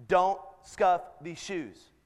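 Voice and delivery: each word set apart, slowly; a parent's slow, deliberate order, retold; retold stern warning. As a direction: spell out the rule word by word.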